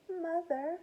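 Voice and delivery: put-on high, thin baby-bat squeak; small frightened squeak. A narrator's reading. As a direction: call out high like a lost baby. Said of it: "Stella Luna squeaked".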